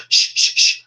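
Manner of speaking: sharp repeated shushes; three urgent hushing sounds, acted out; mock shushing. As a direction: shush someone away while trying to concentrate. Retold shushing of his teacher.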